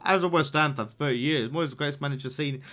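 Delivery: fast, flat reading of a chat message; rushed read-out. Shown as a demonstration. Reading a viewer's message aloud, fast and offhand. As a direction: rattle off the comment quickly.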